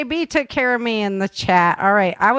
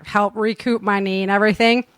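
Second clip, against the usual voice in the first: normal talking voice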